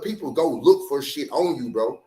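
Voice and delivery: usual speaking voice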